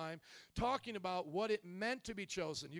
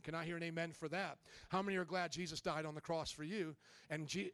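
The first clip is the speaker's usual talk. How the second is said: ordinary talk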